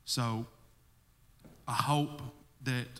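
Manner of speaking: usual speaking voice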